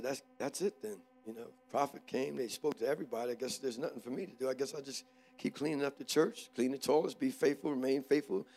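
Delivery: very soft, low, subdued musing; tearful resignation. Retold or imitated. Passed-over man resolving to stay faithful, tearful resignation. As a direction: talk quietly to yourself, resigned and hurt. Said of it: "My eyes got real watery".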